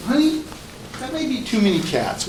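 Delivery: high, soft husband's plea; acted, careful protest to a partner; tentative objection. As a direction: raise the pitch and gently object. Acting husband gently objecting to wife.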